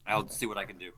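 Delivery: talking while chewing; eating crunchy fried chicken. Real, not acted. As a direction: mouth full of chicken. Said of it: "Sorry, I'm eating ch".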